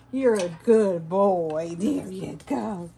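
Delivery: slow, loud pet praise; dog praise. Real, not acted. Praising dog after clipping.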